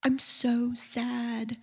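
soft, slow, drawn-out sorrow, acted; tearful sadness. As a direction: hush it like someone pouring out grief in prayer. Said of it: "and cry and cry and cry".

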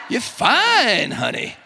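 high, swooping Southern accent; Southerner. Shown as a demonstration. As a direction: croon fine way up high, Southern style. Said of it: "say it like a Southerner".

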